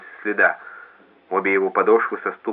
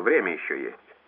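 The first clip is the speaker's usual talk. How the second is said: softer, detached and uninterested; indifferent; 4 semitones lower, 6 dB quieter than usual. A narrator's reading. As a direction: brush it off coolly. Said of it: "равнодушно перебил Невилл".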